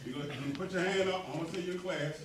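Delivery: ordinary delivery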